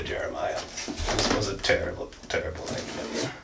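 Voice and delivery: whispered mock regret; mock despairing whisper. To Jeremiah, a mock despairing whisper.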